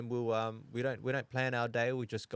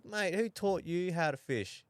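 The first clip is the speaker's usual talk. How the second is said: higher-pitched mock indignation; retold teasing comeback; 8 semitones higher, 3 dB louder than usual. Dad answering his young son's fishing complaint, mock indignant. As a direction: toss back a lightly acted, higher challenge. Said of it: "I go".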